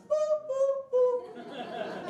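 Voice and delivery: high sung boops like a recorder; recorder imitation. Shown as a demonstration. Comedian plays hot cross buns for the crowd with his voice. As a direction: mimic the three recorder notes. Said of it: "Three notes".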